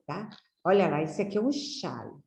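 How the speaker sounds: ordinary talk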